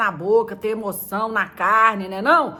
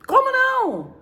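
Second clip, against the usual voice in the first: much higher, louder startled cry; mock shock; 5 dB louder, slower than usual. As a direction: gasp it out in disbelief.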